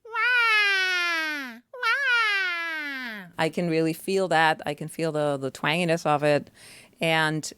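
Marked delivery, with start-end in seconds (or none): 0.1-3.2 high, twangy imitation of a baby crying; mock infant wail. Shown as a demonstration. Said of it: "really like the baby wail".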